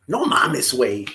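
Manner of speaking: Mexican Spanish accent, "güey" dropped low; casual Mexican street accent; Mexican slang delivery. Shown as a demonstration. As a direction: say it like a Mexican bro. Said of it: "That's how they talk".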